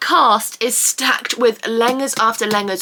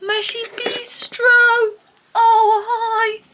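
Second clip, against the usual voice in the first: high cooing pet voice; 12 semitones higher, slower than usual. Real, not acted. Cooing at her dog in high baby talk. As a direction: coo the pet name.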